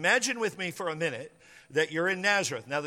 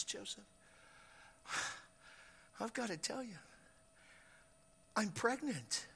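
very soft, with long hesitant pauses; near-whispered, reluctant reveal; hushed confession; 3 semitones higher, 15 dB quieter than usual. Acted. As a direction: confess it quietly, piece by piece. Mary confesses pregnancy to Joseph.

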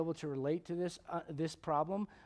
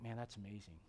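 hushed, much softer voice of wonder; hushed Adam in awe; 8 semitones lower, 14 dB quieter than usual. Retold or imitated. Pastor voicing Adam admiring the horse, hushed awe. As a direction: breathe it like a marveling first man. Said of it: "Adam's like".

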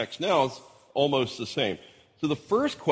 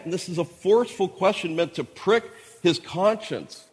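ordinary talk

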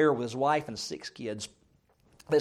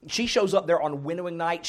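ordinary delivery

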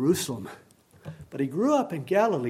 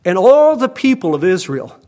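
bold proclamation; 5 semitones higher, 9 dB louder than usual. Retold or imitated. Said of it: "look at what he says to them".

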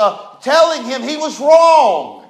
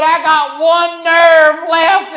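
slow, high, louder scolding; fed-up mother; 6 semitones higher, 6 dB louder, slower than usual. Retold or imitated. Mother telling rowdy son she's at her limit, slow and loud. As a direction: drag it out like a worn-out mom.

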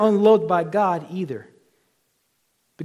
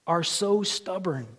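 normal talking voice

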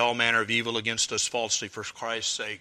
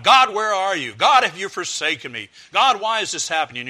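loud, higher anguished cry to heaven; railing at God; 7 semitones higher, 10 dB louder than usual. Retold or imitated. Sufferer railing against God for abandoning him, loud and anguished. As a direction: hurl the questions up at God. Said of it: "to kind of rail against God".

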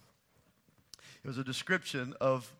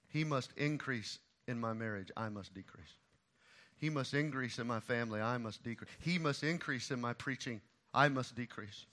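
he and I stressed, I softer; contrastive stress; 3 semitones lower, 4 dB quieter than usual. Real, not acted. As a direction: contrast he against I. Repeated motto, varied.

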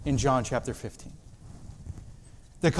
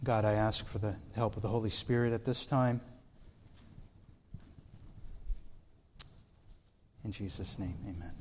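softer, hushed prayer voice; 7 semitones lower, 12 dB quieter, faster than usual. Acted. Pastor asking God for help before preaching, hushed prayer. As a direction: pray quietly and humbly.

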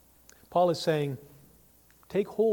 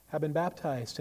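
ordinary talk, nothing marked

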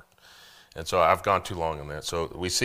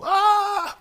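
sudden very high shriek of fright; shrill roller-coaster squeal; mock scream; 11 dB louder than usual. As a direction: scream like a scared wife on a coaster.

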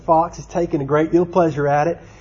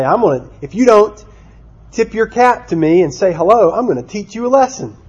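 high-pitched storybook threat; growing frustration; 7 semitones higher than usual. Acted. Rabbit threatens the silent tar baby, mock frustrated. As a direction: threaten with hammed-up indignation, voice raised in pitch. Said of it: "his frustration began to grow".

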